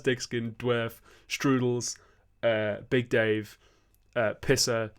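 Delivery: ordinary delivery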